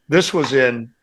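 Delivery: ordinary talk, nothing marked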